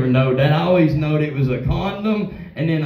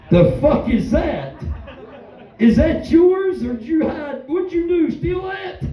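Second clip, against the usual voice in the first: shocked dad; 8 semitones higher than usual. Retold or imitated. Stand-up telling his son about the towel, rising shocked cry. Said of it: "I'm like".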